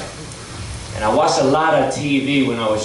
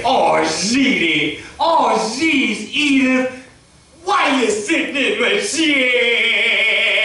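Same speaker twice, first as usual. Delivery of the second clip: slow, higher grumbling complaint; Archie Bunker; 11 semitones higher, much slower than usual. Retold or imitated. Archie scolding Edith for sitting in his chair, slow and higher. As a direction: drag it out, put-upon husband. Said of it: "He's like".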